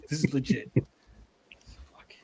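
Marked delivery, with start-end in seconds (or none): 0.0-0.7 mock Mexican accent, laughing through it; हँसते हुए हल्का नकली मैक्सिकन लहजा. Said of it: "that was a Mexican accent [name] was doing".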